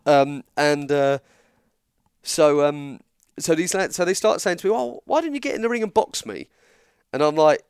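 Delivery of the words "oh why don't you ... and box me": a light Irish traveller challenge, pitched up; lightly voiced Irish lad daring him to box; Irish accent. Traveller lad challenging the wrestler, lightly voiced Irish accent. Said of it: "the accent".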